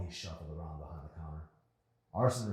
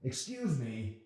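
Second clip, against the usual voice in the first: slow, curt angry bark; impatient man barking; 8 semitones higher, 4 dB louder, much slower than usual. Acted. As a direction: snap at her to wait your turn. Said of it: "he barked".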